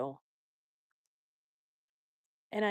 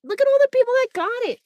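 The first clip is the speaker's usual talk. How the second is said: excited; 12 semitones higher, 9 dB louder, faster than usual. Real, not acted. Excited about memberships.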